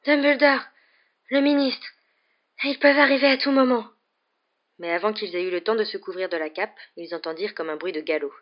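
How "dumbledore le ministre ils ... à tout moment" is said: high, loud urgent warning in bursts; breathless high-pitched alarm; panting. A narrator's reading. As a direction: break it up as if winded. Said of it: "haleta Hermione".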